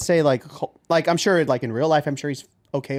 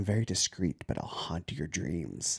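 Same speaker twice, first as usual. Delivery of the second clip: low, hushed, slow tease; softly voiced creepy come-on; sleazy boss; 8 semitones lower, 11 dB quieter, slower than usual. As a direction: drop low and quiet, drawing it out. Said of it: "The boss, who's like".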